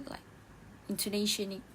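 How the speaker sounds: ordinary delivery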